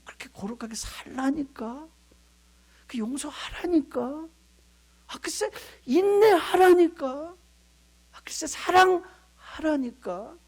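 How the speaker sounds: patiently exasperated, spelling it out; exasperated God. Retold or imitated. (답답하다는 듯 천천히 되풀이하는 하나님 목소리)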